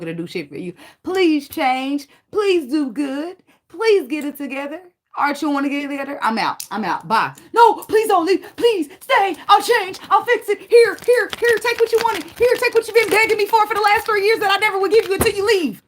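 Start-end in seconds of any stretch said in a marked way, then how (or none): 1.0-7.3 mock pleading woman. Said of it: "I'm being dramatic and I'm being sarcastic". 7.5-15.8 high, loud, hammed-up desperate pleading; dramatic mock begging. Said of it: "I'm being dramatic and I'm being sarcastic".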